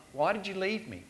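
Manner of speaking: normal talking voice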